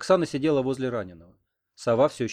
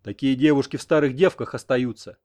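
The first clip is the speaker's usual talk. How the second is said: louder, offended retort; 7 dB louder than usual. A narrator's reading. Grandfather offended for his grandson, offended retort. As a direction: hit back, stung on someone's behalf. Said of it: "обиделся дед за внука".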